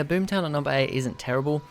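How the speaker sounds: normal talking voice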